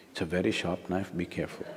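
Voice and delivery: low, very soft warning; retold cautious warning. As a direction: quote a discreet word of caution. Retelling the repeated quiet warning.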